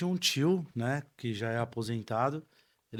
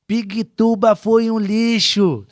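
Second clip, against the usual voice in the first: loud, slow, high barked order; lisping instructor yell; 8 semitones higher, 13 dB louder, slower than usual. Retold or imitated. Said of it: "tinha um instrutor que ele tinha a língua presa".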